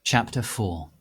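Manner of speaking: usual speaking voice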